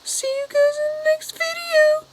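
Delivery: very high cartoon voice; squeaky sign-off. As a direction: chirp the goodbye like a chipmunk.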